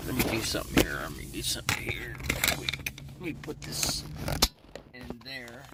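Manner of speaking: hushed whisper while busy. Real, not acted. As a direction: mutter it in a hushed whisper off to the side. Whispering while fixing nose off-mic.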